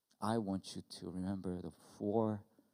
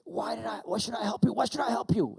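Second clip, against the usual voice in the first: high, loud repeated protest; re-enacted exasperated shout; indignant dad; 13 semitones higher, 10 dB louder than usual. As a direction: protest angrily to your kid. Acted quarrel with his daughter.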